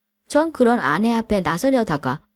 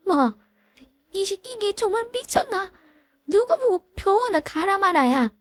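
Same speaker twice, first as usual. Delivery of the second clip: higher voice, heated insults traded; furious female bickering; 8 semitones higher than usual. Acted. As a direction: spit the insults at your old bully. Hair-pulling fight, shouting insults. (머리채 잡고 싸우는 여자들의 격한 말싸움)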